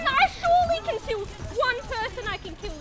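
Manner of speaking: loud high yell at teammates; indignant gamer yell. Real, not acted. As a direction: shout it out in mock outrage. Gaming, yelling at teammates.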